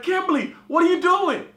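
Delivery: shouted high, calling across the enclosure; panicked yell. Retold or imitated. Yelling at friend inside the lion's den, panicked. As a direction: yell her name in alarm. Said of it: "I was like".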